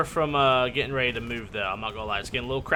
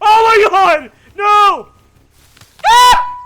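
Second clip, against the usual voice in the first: high, loud screaming panic; panicked scream; 17 dB louder, much slower than usual. Real, not acted. Attacked by an alligator, screaming in panic. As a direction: shriek in terror.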